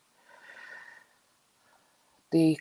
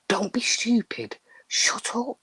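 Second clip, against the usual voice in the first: higher-pitched mock telling-off; retold scolding; 7 semitones higher than usual. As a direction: act out shushing a silly child. Parent scolding son at coffin.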